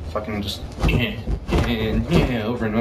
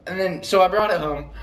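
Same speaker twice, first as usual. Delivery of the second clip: usual speaking voice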